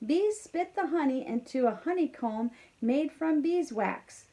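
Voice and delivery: lively storybook read-aloud; reading to children. A narrator's reading. Teacher reading a farm story to kindergarteners, lively. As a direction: read it brightly to a class of kids. Reads picture book aloud.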